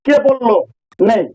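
usual speaking voice

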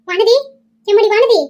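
high-pitched summoning call; alarmed calling out. Acted. Woman calling for Vanathi in the wrecked house, alarmed. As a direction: call urgently through the house.

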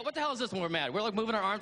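ordinary talk, nothing marked

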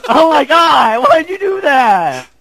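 high, drawn-out wail of protest; outraged protest. Real, not acted. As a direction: cry out at a friend's betrayal. Protests being killed by co-player.

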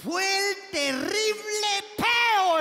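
milk the word peo, slowly; drawn-out peo. Acted. Comedian dramatizes his fart to the audience, slow and high.

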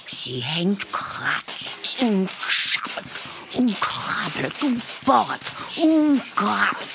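slow, drawn-out words of toil; laborious effort. A narrator's reading. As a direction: stretch each digging verb with effort. Narrator acts out laborious digging.

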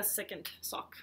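ordinary talk, nothing marked

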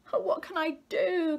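high, loud storybook wail; distressed cry. A narrator's reading. Mother despairs as her child leaves, crying out.

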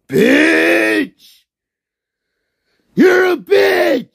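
high, loud screaming insult; furious shout. Real, not acted. Streamer screaming insults at chat, furious shout.

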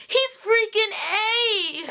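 high, slow cried-out accusation; crying shout. Retold or imitated. Fan imitating Spencer on the rails, crying out. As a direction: wail it like a desperate realization. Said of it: "she's like".

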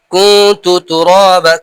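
কুনতু with the nun hidden and hummed through the nose; slow nasal ghunna on কুনতু তুরাবা; nasal ghunna demo. Shown as a demonstration. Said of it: "নাকে উঠে যাচ্ছে".

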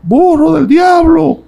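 very high, slow, unearthly calling voice; eerie demon voice. Retold or imitated. Retelling the demon's voice heard in the night, high eerie call. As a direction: drag it out high like a spirit calling from afar. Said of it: "Yo oí una voz que decía".

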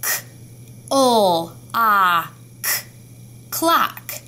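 segment clock and blend; teacher modelling sounds of clock; sounding out. Shown as a demonstration. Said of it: "Tell me the sounds of clock".

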